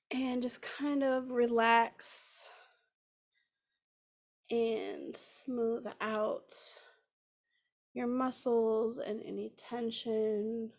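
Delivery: unhurried, soothing guide into the breath; guided meditation. Acted.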